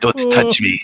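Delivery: high sharp snap; high-pitched snap. Acted. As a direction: shove the words away, high. Character shoves him off.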